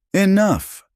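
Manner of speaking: loud, high barked order; sharp command. A narrator's reading. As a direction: cut the room off with one word. Crowell slams table, silences room.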